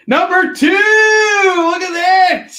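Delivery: loud, high, slow stretched cry of "Look at that"; excited shout. Real, not acted. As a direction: hype it up like a fairground barker. Excited announcing comic.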